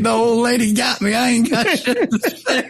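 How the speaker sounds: high, laughing admission of defeat. Retold or imitated. Bo admitting the old lady out-joked him, high and laughing. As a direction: crack up conceding the point. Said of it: "I was like".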